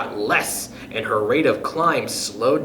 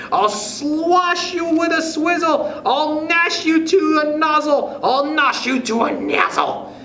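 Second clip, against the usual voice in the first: raging shout; 12 semitones higher than usual. A narrator's reading. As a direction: spit out each word in rage.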